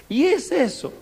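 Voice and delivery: high-pitched, incredulous question; comic shock. Retold or imitated. New father seeing his baby's odd head, comically shocked. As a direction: blurt it out in hammed-up disbelief.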